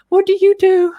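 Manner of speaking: voicing someone else, pitched well up; imitating the woman.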